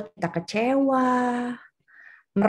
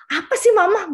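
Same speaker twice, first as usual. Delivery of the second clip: whiny protest; 9 semitones higher, 3 dB louder than usual. Retold or imitated. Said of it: "gitu ya".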